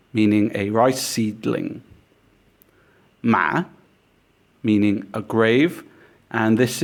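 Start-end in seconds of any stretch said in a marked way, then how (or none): 3.2-3.6 "Ma" dipping, then rising like a question. Shown as a demonstration. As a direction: ask "Ma" as if doubtful. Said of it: "questioning tone".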